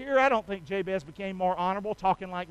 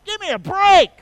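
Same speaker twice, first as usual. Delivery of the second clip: mock demand; 9 semitones higher, 10 dB louder than usual. Preacher mocking demand.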